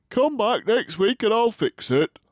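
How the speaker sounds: high put-on dentist voice; dentist's voice. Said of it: "said Doctor Fields".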